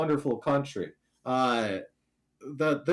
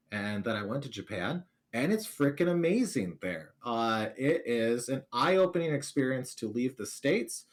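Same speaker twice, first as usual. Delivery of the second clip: ordinary talk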